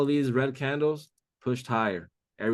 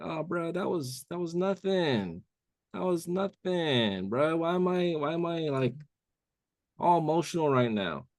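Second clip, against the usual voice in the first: mock shaken trader; 6 semitones higher than usual. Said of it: "You're like".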